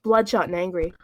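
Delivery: reading a fanfic aloud to the audience; story reading. Acted. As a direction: read the story straight through.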